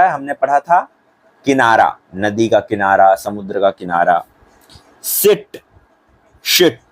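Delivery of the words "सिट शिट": सिट said higher with a thin s; शिट with a sh sound; sit versus shit. Shown as a demonstration. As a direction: drill सिट then शिट. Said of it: "सिट मतलब होता है बैठना".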